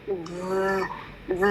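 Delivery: long buzzing voiced TH on its own, written "v"; isolated TH sound. Shown as a demonstration. Teacher demonstrates the TH sound, drawn out on "v". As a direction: hold the "v" as a voiced TH. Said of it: "xem lại cái âm the".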